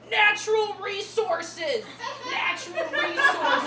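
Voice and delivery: high, slow, loud drinking cry; Sabrina. Retold or imitated. Friend toasts every shot, high drawn-out cheer. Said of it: "my friend Sabrina would be like".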